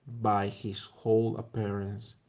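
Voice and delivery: usual speaking voice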